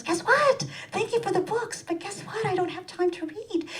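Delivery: high, sing-song mocking mother; mother's voice. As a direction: mimic mom's chirpy brag. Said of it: "she goes".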